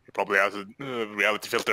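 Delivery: low, deep put-on voice; dropping voice deep. Shown as a demonstration. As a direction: sink the voice down deep. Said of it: "You need to do a deeper voice".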